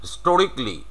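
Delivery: "হিস্টোরিকলি" shortened to historik-li; "হিস্টোরিকলি" ending in "rik-li"; native-style "historically". Shown as a demonstration. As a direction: say "হিস্টোরিকলি" with no "kaa". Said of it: "উনি বলবে".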